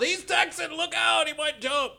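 high shouted mock warning; mock warning yell. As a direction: yell it like an alarmed bystander. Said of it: "they could have yelled".

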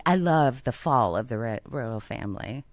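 lightly mock your co-host's declaration; imitating co-host. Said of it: "You're like".

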